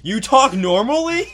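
high, loud, drawn-out disbelief; incredulous. Acted. Acted shock at Yoda's normal speech.

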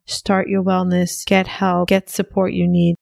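upbeat sponsor read, pushing the offer. Acted. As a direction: sell it like a podcast ad.

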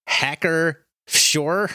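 "Hacker Schorr" drawn out very slow, German-ish and unsure; unsure German name. Real, not acted. Host reading a German beer label, unsure pronunciation. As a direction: slowly try out "Hacker Schorr" as if guessing at German. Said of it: "I have no idea how to say this".

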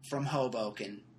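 normal talking voice

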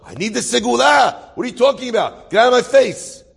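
loud, high acted-out outburst; indignant refusal. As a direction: push someone away with an outraged protest. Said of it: "imagine the man says to himself".

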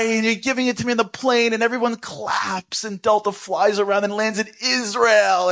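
very high, slow storybook awe; mock-epic excitement. Daydream about the miracle package, high gushing awe. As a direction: gush like the dream is coming true.

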